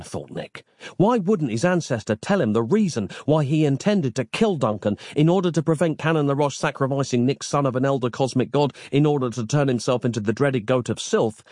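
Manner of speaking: ordinary delivery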